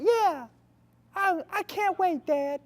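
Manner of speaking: put-on high squeaky little kid voice; little boy. Five-year-old voiced in story.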